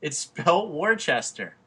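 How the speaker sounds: high, loud and slow; insist, word by word; loud slow insistence; insistent. Real, not acted. Heated argument over spelling.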